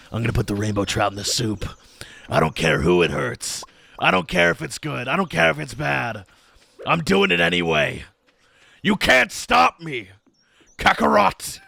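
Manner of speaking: defiance building to a high, loud anime-villain taunt; mock evil bravado rising to a shout; hammed-up villain. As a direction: gloat like a cartoon rival who won't be stopped. Defiant villain taunt about the soup.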